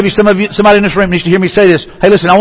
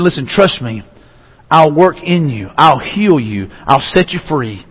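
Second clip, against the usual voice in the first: lower, softer voice of a reassuring Jesus; hushed, low promise with pauses between phrases; tender reassurance; 5 semitones lower, 4 dB quieter, slower than usual. Acted. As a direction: gently ask for their trust.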